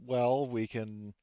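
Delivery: ordinary talk, nothing marked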